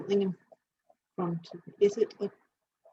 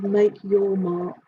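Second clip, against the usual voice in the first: drawn out slowly, each word weighted; slow solemn message; 4 dB louder, much slower than usual. Retold or imitated. Relaying the dog's message, slowly and solemnly. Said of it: "It says".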